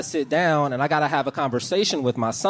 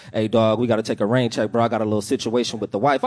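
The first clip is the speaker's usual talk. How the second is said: lower, sheepish friend cancelling; buddy voice; 6 semitones lower than usual. Retold or imitated. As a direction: play the friend backing out, low and apologetic. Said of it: "He was like".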